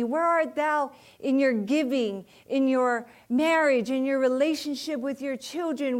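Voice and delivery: loud, searching call in God's words. Acted. Voicing God calling her by name.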